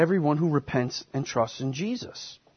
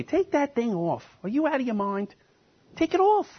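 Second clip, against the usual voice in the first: higher, jeering ridicule rising at the end; mocking scoffer; 8 semitones higher than usual. Scoffer ridiculing a passenger to drop the parachute, jeering. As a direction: scoff at him as if he's crazy. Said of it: "what if they started making fun of you?".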